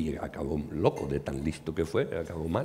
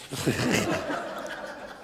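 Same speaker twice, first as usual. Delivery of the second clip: laugh; 6 semitones higher, 4 dB quieter than usual. Real, not acted. Laughs at his own punchline.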